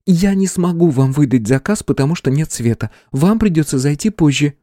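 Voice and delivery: put-on high, tense young woman. A narrator's reading. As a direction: voice a nervous clerk lightly. Clerk refuses robbers.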